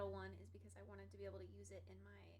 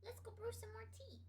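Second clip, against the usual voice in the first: pet voice; 10 semitones higher than usual. Real, not acted. Baby talk to cat.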